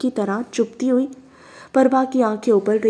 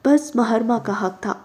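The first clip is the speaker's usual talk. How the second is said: ordinary delivery, nothing marked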